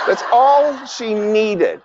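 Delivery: slow emphatic. Real, not acted.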